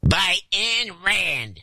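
high, slow put-on whine; whiniest voice. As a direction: whine it out in a hammed-up voice. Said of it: "the whiniest voice he can muster".

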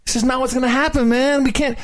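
high-pitched pleading dude voice; mock presidential begging, high and urgent; Barry, imitated. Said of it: "He's like".

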